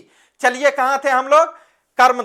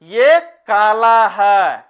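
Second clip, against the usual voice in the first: slow stressed answer; 3 semitones lower, 4 dB louder, much slower than usual. Shown as a demonstration. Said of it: "लेकिन इसका विशेषता क्या है?".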